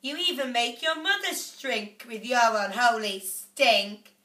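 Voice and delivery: nagging mother. A narrator's reading. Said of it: "the mother said".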